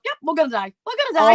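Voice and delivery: half-joking dread that builds to a cry; mock panic. Thinking they will die in storm.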